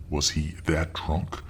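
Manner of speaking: ordinary delivery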